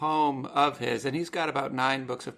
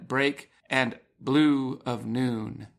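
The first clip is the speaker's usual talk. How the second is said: slow, louder reading that punches each beat; strong beats read; 3 dB louder, much slower than usual. Shown as a demonstration. Host reads the poem line to listeners, slow beats stressed. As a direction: read it slowly and hammer the two strong beats. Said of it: "Two strong beats on the left".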